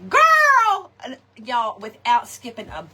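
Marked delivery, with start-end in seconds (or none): 0.1-0.9 put-on high loud call of girl. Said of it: "let my older sister know".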